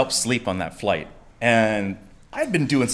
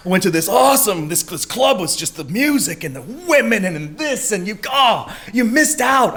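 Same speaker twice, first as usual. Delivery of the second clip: higher, raving about the club; excited buddy; 8 semitones higher than usual. Retold or imitated. Buddy raving to the sleepy teller about the club, higher. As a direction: gush about last night. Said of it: "and he goes".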